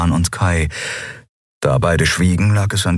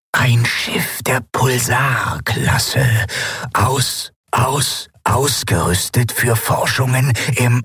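higher, slow, stuttering machine voice that keeps glitching; failing machine voice; 6 semitones higher, slower than usual. Acted. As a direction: stammer like a broken machine. (stotternde, defekte Maschinenstimme)

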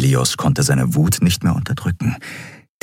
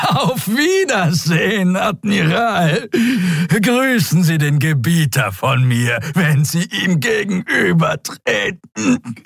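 much higher, slow, triumphant creature cry; mocking farewell; 11 semitones higher, slower than usual. Dying reptile prisoner mocking the admiral, triumphant. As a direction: crow your goodbye.

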